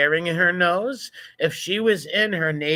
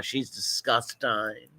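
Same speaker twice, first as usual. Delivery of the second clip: normal talking voice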